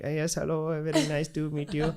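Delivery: polite greeting in a mock Indian accent; put-on Indian accent. Pretending to be Abu meeting the girl, put-on Indian accent.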